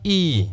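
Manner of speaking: "e" held long as "eee", falling; a sustained "ee"; long vowel E. Shown as a demonstration. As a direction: draw out the "e". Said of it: "has the long vowel sound e".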